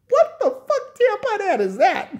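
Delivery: high, loud disbelieving shriek; high shocked screech; incredulous shriek. Real, not acted. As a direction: shriek at the absurdity.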